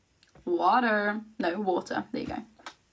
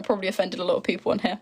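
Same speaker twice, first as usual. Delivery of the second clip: ordinary delivery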